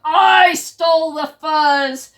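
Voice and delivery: loud barked boast from above. Acted. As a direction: bark it down at them. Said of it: "barked a voice from above".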